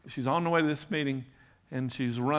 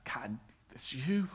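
hushed, awed whisper to God; startled whisper; 6 dB quieter, slower than usual. Retold or imitated. Paula in a church pew, whispering to God in shock. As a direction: breathe it out in disbelief.

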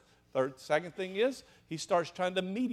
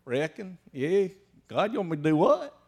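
backwoods shepherd Moses; 7 dB louder than usual. Retold or imitated. Preacher mimicking Moses after years with sheep, lightly rustic. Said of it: "Probably talk like this".

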